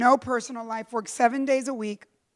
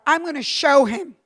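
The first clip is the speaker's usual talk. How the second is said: defiant resolve; 7 dB louder than usual. Retold or imitated. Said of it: "in my anger, I thought".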